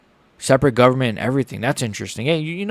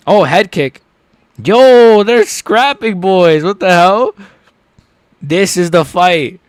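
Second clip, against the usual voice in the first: loud, high fight-fan hype; excited shouting; 7 semitones higher, 7 dB louder, slower than usual. Real, not acted. Streamer hyping a head kick to viewers, excited shouting.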